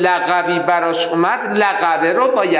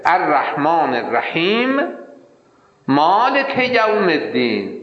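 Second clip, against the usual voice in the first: ordinary talk, nothing marked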